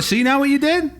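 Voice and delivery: loud, high, aggrieved complaint; hammed-up indignation; mock angry blame. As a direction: blame a stranger for upsetting the family. Acted hothead blames the robot.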